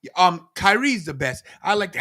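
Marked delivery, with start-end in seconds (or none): none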